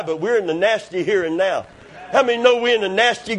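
usual speaking voice

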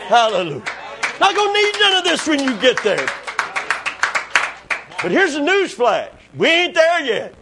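high, rousing preacher voice; fervent preaching. Real, not acted. Preacher telling congregation we ain't there yet, fervent preaching.

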